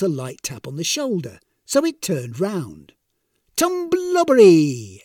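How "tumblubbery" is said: high, loud, drawn-out cry; gleeful exclamation. A narrator's reading. Tanisan pinching Death's waist, exclaiming loudly. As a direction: sing it out slowly as a triumphant tease. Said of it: "exclaimed Tanisan".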